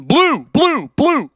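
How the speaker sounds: high, loud repeated mock shouts; punchy high-pitched parroting of the chat; mimicking chorus of viewers. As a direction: imitate a crowd calling out guesses. Imitating many viewers' guesses.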